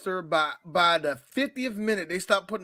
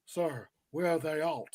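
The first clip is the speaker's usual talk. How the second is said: slow, much softer mumbling Cage; Nicolas Cage impression; 5 semitones lower, 7 dB quieter than usual. Friends mock Cage dropping the accent, slow soft mumble. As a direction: mumble it lazily, like Nick Cage. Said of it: "Here's Nicolas Cage".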